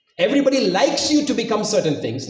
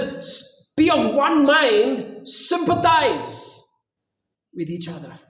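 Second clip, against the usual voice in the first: scripture reading; 8 semitones higher, much slower than usual. Acted. Slow emphatic scripture reading.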